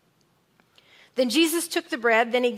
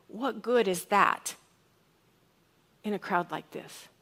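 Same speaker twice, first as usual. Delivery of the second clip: slow "What good is that", long pause, then hushed "in a crowd like this"; slow, hesitant question; 3 semitones lower, 5 dB quieter than usual. Shown as a demonstration. Preacher imagines Andrew approaching Jesus, slow and hesitant. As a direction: ask it slowly, trailing into a low, soft ending. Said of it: "What if he went to Jesus like this".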